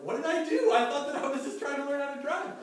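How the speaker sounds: high, loud bewildered outburst; a teen's loud, shocked self-defence; indignant protest. Retold or imitated. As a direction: cry out what did I do, wronged and baffled. Son protests mom's verdict.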